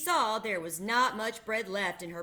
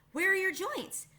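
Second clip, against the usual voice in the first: higher, worried question; anxious; 12 semitones higher than usual. A narrator's reading. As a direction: ask with anxious urgency. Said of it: "asked anxiously".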